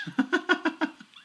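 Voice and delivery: loud high burst of laughter. Real, not acted. Commentator cracking up at own accent joke, high laugh. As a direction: cackle at your own joke.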